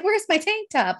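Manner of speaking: high-pitched retold fluster; acted-out bewildered dismay; mock panic. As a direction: pitch it up in comic alarm. Acts out panic while falling naked.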